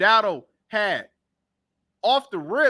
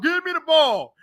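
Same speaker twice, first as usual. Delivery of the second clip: higher, whiny begging; mock crying whine; 6 semitones higher than usual. Mocking T O begging for the ball, whiny. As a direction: whine it like a spoiled kid. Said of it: "T O was crying all week talking about".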